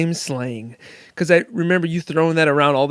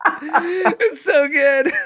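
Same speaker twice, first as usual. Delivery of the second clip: delighted; 13 semitones higher, much slower than usual. Real, not acted. Delighted laughing reaction.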